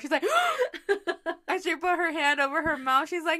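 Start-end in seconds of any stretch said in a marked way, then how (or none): 0.3-1.4 high shocked gasp; sharp gasp. Retold or imitated. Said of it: "she gasped so hard".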